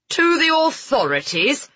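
high, indignant outburst; blustering. Acted. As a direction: bluster like an offended lord. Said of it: "Lord Westrom blustered".

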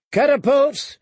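high shouted command; calling out. A narrator's reading. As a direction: call to the siege crews over battle. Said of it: "he called".

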